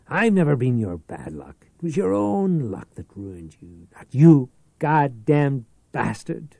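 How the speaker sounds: plain reading voice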